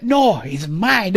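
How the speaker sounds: drawn-out, insistent claim; mock possessive. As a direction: stretch it out like a jealous rival. Acted possessive claim in retelling.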